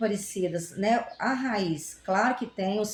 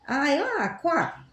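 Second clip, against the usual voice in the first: higher, playful impression of dad saying quá; imitating her father; 5 semitones higher than usual. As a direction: mimic the old man's quá, lifted and light. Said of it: "Ele falava isso".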